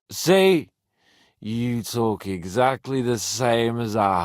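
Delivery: put-on slow, loud drunken scolding; drunk rant. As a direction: slur at him, drunk and loud. Drunk lecturing friend.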